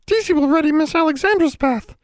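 high squeaky house elf voice. Acted. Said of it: "Teasy said".

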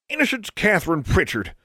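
put-on high old granny calling out a child's full name; stern old granny. A narrator's reading. Great-grandmother catching the snooping girl, high stern granny voice.

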